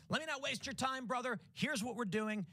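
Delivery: high, punchy, straight-to-business pitch; brisk no-nonsense pitch. Shown as a demonstration. Canvasser pitching a man in a suit, high and punchy. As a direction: cut the fluff for a busy suit. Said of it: "the pitch to him is always going to be".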